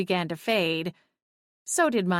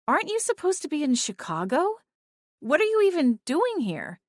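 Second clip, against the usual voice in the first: incredulous; 8 semitones higher than usual. A narrator's reading. Cora questioning Clint.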